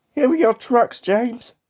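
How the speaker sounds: high-pitched, loud teasing voice; cheeky tank engine. A narrator's reading. Little tank engine to James, teasing.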